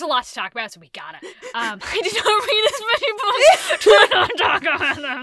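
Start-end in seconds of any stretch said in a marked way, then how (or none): 1.8-5.2 laughing through the words, higher and louder; sheepish laughing confession. Real, not acted. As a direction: giggle it out, a bit embarrassed. Laughing through embarrassed admission.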